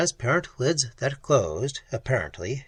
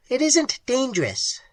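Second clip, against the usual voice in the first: much higher, shrill creature voice; shrill clicking; 11 semitones higher than usual. Acted. Creature correcting Pat, shrill clicking voice. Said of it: "remarked the thing".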